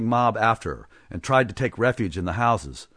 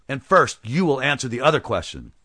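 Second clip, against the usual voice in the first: loud, higher demanding cry; cried out; 4 semitones higher, 4 dB louder than usual. Acted. Blacksmith as hero of the crowd, loud cry. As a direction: press him hard before everyone. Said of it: "cried the blacksmith".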